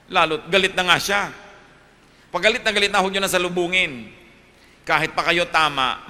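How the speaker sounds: a preacher urging the brethren with fire; fervent preaching. Acted. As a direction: preach it out to the congregation. Preaching at full force.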